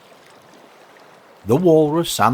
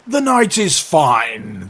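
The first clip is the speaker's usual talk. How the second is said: slow, loud, raised pronouncement; evasive walrus; 5 semitones higher, 6 dB louder, slower than usual. Acted. Walrus ignoring oysters' plea, airily pompous. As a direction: remark grandly, drawing it out.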